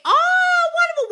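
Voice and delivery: high, loud gush of delight. Real, not acted. Reacting to a viewer's affirmations working, high excited gush. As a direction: squeal with joy at the news.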